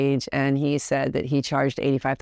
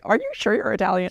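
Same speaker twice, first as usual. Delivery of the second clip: teasing daughter; 5 semitones higher than usual. Retold or imitated. Teasing question to father.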